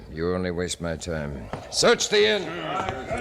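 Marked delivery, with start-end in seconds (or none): 1.7-3.2 much higher, slow, drawn-out command; barked order. Acted. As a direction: call it to the troops outside. Roman officer orders his men.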